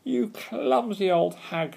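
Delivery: high, drawn-out screech; witch's high-pitched scolding; angry shriek. A narrator's reading. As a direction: shriek the insult slowly and furiously. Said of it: "she shrieked".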